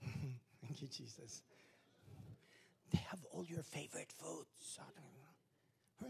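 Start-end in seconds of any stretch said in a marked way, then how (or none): 2.9-6.1 hushed, slow, sly whisper; tempting whisper. Retold or imitated. Tempter luring churchgoer to party, whispered.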